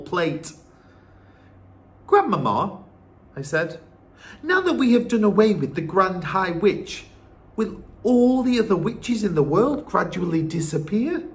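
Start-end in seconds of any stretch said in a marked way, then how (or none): 2.1-11.2 put-on higher, louder boy voice; earnest boy with a question; eager young grandson. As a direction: ask grandmother keenly, pitched up.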